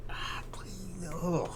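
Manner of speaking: a whispered, weary groan of please; exasperated whisper. Real, not acted. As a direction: whisper it, fed up. Exasperated at the toy.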